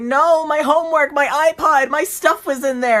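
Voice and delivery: high, loud whiny wail. Retold or imitated. Bully watching his backpack go out the window, loud whiny wail. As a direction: wail like a kid who lost everything. Bully wails over lost stuff.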